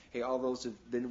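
usual speaking voice

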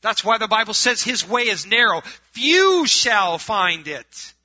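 loud proclamation with long drawn-out words; full-force preaching. Acted. Preacher quoting the Bible's warning to his church, full-force preaching. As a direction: proclaim it slowly and loudly as a warning.

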